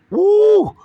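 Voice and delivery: a sudden high whoop; preacher's high whoop; high woo. Real, not acted. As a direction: let out a high excited woo. Preacher's exclamation.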